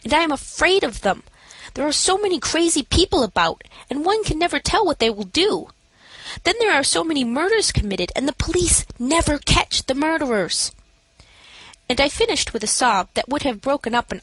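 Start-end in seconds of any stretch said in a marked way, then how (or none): 0.0-10.7 higher, anxious rambling; feigned terror. A narrator's reading. Narrator feigns terror of murderers to the matron, higher and anxious. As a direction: work yourself up with fear.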